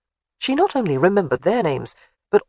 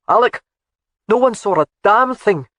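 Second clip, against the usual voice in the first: loud call across the room, raised pitch; 5 semitones higher, 6 dB louder than usual. A narrator's reading. Soldier calling out to his laird, loud and raised. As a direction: call to him from a distance. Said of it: "Gavin called out to him".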